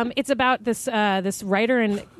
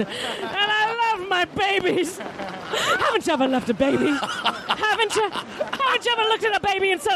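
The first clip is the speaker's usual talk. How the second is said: high, hammed-up drunken mother pleading her case; a desperate alcoholic mom, pitched up and stretched out; yelling at the court; 4 semitones higher than usual. Mother on trial shouts at the jury to get her kids back, hammed up. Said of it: "She's yelling at the court".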